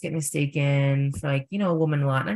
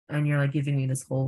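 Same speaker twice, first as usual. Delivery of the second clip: normal talking voice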